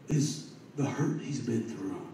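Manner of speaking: ordinary delivery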